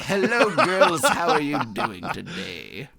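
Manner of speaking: hammed-up sexy star greeting the ladies; Charlton Heston. Shown as a demonstration. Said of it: "it just came out Charlton Heston".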